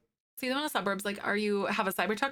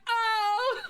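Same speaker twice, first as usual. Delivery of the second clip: much higher, laughing coo; cooing squeal; 13 semitones higher, 4 dB louder than usual. Retold or imitated. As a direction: melt over something adorable. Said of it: "I'm like".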